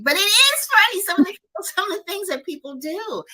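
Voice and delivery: high, squealing through laughter; real amusement. Real, not acted. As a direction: burst out, laughing as you talk. Cracking up over sister's funny reels.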